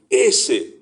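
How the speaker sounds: single out one person with "ese"; pointing stress. Shown as a demonstration. Preacher repeating Christ's words to the church, stressing "ese". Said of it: "como que lo está señalando".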